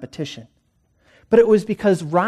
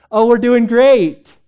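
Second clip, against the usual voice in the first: loud, slow, higher hammed-up cheerfulness; fake cheer; 7 semitones higher, 8 dB louder, slower than usual. Couple greeting the church greeters, hammed-up fake cheer. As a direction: put on a fake smile for the greeters. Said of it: "tape on a fake smile".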